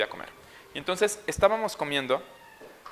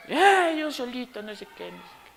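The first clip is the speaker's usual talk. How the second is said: niño en berrinche; 10 semitones higher than usual. Retold or imitated. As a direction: mimic a whining child, dying away. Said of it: "si estás gritando". (quejido agudo de niño que se va apagando)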